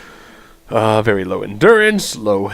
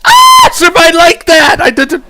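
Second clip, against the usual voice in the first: very high, loud squealing yell; sudden loud shriek; shrieked outburst; 10 dB louder than usual. Pretend. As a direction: burst out in a piercing high shout. Sudden shrieked outburst while reading.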